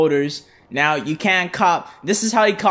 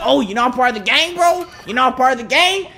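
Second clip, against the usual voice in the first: raised-pitch incredulous challenge; confrontational; 6 semitones higher than usual. Real, not acted. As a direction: challenge them, pitch climbing on each question. Challenging gang members in game.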